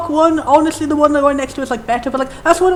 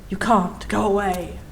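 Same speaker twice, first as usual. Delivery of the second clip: low, quiet jumper; 6 semitones lower, 7 dB quieter, slower than usual. Acted. Jumper refuses help.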